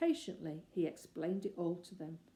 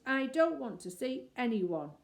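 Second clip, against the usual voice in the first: booming; 6 semitones higher, 6 dB louder than usual. Acted. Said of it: "said a booming voice".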